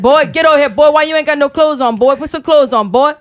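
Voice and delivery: a hint of a Cajun grandpa scolding the boy. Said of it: "Oh, Papa said".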